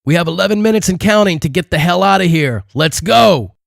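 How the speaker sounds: high, strained shout over the din; screaming over noise. A narrator's reading. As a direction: yell above roaring noise. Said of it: "screamed over the ear-splitting noise".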